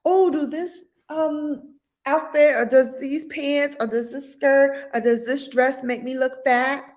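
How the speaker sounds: put-on high, girly questioning voice; girlfriend asking. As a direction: play the anxious girlfriend fishing for reassurance. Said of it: "That's not the man you ask".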